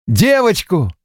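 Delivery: high, delighted cry; proud new father crowing over the phone; rapturous shout. A narrator's reading. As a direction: shout the news with glee. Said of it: "восторженно прокричал Стивен".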